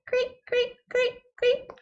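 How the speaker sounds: high, soft mock chirping of crickets. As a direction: chirp like crickets in a silent room. Imitating crickets, silent chat.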